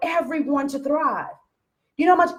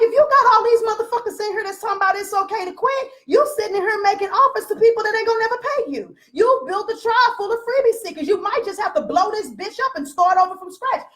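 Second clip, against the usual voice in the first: higher, pushed blunt warning; blunt lecture; 7 semitones higher than usual. Retold or imitated. Coach telling a client about her freebie group, blunt. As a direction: tell them straight to blow it up. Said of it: "I'm like".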